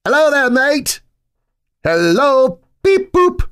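high, loud put-on voices trading hellos; hammed-up robot and mate voices; silly mock voices. Boys mocking each other with silly high voices.

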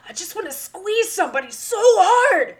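high, loud, straining outburst; mock tantrum. Teacher imitating angry pupil wanting to squeeze, mock straining rage. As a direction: imitate a kid bursting with frustration.

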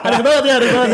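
laughing. Real, not acted. Laughing at awkward parent talk.

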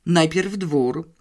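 ordinary delivery, nothing marked

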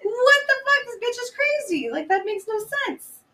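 high-pitched disbelief, loud at the start; incredulous outburst. Retold or imitated. Host reacting to the bitch being crazy, incredulous. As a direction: blurt it out, appalled and baffled.

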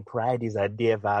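slow, light imitation of a Jamaican accent. Imagining the singer on that hook, light Jamaican accent. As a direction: draw it out with a little Jamaican lilt. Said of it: "that little Jamaican accent he likes to do".

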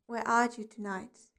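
ordinary delivery

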